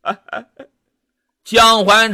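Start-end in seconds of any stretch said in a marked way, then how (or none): none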